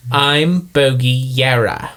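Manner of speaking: slow, deliberate character voice; careful reset into the accent; Bogey Yara. Acted. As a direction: find the character again, word by word. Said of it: "You have to get it back".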